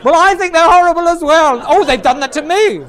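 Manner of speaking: hammed-up outraged busybody; mock gossiping woman. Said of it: "some older women immediately say".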